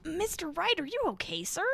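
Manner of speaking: high, concerned little voice; worried character. Acted. Helper checking on Mister Wright, worried.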